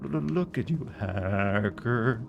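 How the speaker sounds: quiet stuttering glitchy AI voice; Shodan impression. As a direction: taunt like a broken menacing machine. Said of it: "did a Shodan impression".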